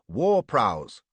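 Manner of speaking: loud sudden call of alarm; loud shout of warning; alarmed cry. Acted. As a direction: sing out at the sight of the war boats. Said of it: "cries Stuart".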